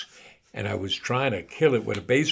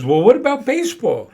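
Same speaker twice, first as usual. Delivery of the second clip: put-on old man voice; 11 semitones higher, 12 dB louder than usual. Mo asks ghost.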